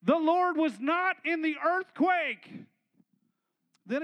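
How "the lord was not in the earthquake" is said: slow, loud and heavy; a slow booming proclamation; booming emphasis. Acted. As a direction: proclaim each word, big and slow. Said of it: "But it says".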